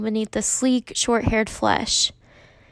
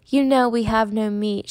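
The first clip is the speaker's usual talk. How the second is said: plain reading voice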